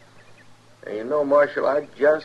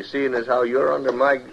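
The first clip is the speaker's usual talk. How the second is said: ordinary delivery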